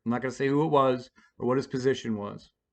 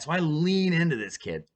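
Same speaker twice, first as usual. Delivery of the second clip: ordinary talk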